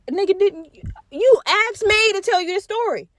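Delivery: high-pitched, incredulous wife; confused. Retold or imitated. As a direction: imitate a baffled wife protesting. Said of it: "the wife is confused as fuck".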